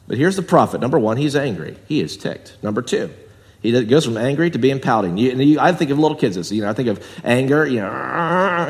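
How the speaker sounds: usual speaking voice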